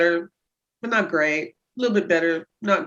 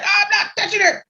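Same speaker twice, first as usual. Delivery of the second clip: stiff husband; 13 semitones higher, 3 dB louder than usual. Retold or imitated. Husband's stiff refusal quoted.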